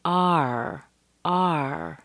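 "R" held long as one drawn-out vowel gliding into r; careful AR sound. Shown as a demonstration. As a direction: stretch out "R" clearly. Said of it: "Be careful of the A R".

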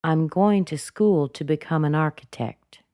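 "architect" stepping down to finish; falling intonation. Shown as a demonstration. Teacher leading repeat-after-me, final fall on "architect". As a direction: let "architect" drop as the last word. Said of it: "the falling intonation on the last sentence".

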